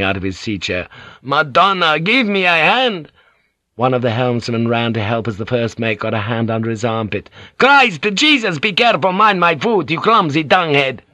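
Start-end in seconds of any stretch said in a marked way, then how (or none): 1.2-3.1 high, strained call; urgent call for help. A narrator's reading. Struggling captain calls for help. 7.6-11.0 high, loud irritated outburst; angry cursing. A narrator's reading. Captain snaps at clumsy helper.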